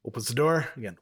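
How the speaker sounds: usual speaking voice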